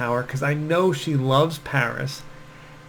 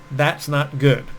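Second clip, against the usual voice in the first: ordinary talk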